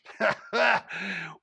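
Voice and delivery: a cowboy's amused laugh; cowboy laughing at the lawman's fear; hearty laugh. A narrator's reading. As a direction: laugh it off in his face.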